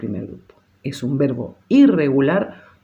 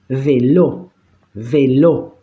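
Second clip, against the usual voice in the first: "Vélo" with a punched, rising final lo, twice; stress on LO; 2 semitones lower, much slower than usual. Shown as a demonstration. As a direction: hit the lo in each "vélo". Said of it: "acentúo en lo". ("vélo" acentuado en la última sílaba, lo)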